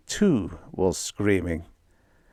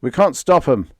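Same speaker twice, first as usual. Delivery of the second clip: louder frightened cry; screaming; 6 semitones higher, 6 dB louder than usual. Acted. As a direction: cry out that they'll break through. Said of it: "screamed Donna".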